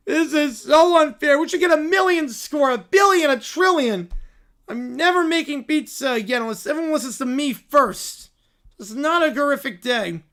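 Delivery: put-on high, loud, slow childish whine; whiny kids. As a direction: beg for a trillion points. Kids demand huge score.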